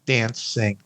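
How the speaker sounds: ordinary talk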